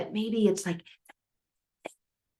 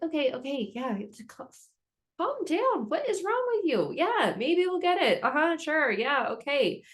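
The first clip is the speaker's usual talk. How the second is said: dismissive parent; 9 semitones higher than usual. Retold or imitated. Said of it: "and they're like".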